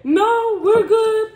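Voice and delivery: nervous reply. Retold or imitated. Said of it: "the driver was kinda nervous".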